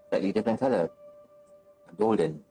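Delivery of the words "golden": ordinary talk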